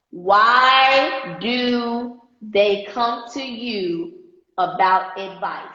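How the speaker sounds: drawn-out, dictation-paced question; deliberately slow. Real, not acted. Coach dictating a question for viewers to type, said slowly. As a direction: stretch each word out for note-takers. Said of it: "I'm gonna say it slow".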